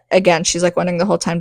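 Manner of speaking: ordinary talk, nothing marked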